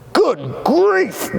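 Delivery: high mock-dismayed exclamation; hammed-up snooty groan; groaned good grief. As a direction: throw it up like a scandalized snob. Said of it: "groaned the ones".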